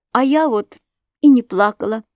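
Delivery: ordinary delivery, nothing marked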